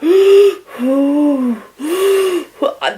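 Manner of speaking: loud, drawn-out high gasping breaths; mock hyperventilation on gas and air; imitated hyperventilating. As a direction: suck in and blow out hard on the gas. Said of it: "I was hyperventilating on this gas".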